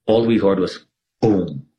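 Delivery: ordinary talk, nothing marked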